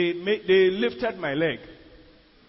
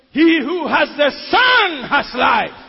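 slow, loud preaching shout; preached shout; 4 semitones higher, 9 dB louder, slower than usual. Shown as a demonstration. Preacher repeating his key verse to the church, slow loud shout. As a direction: proclaim the verse with full force.

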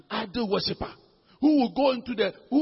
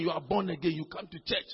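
ordinary delivery

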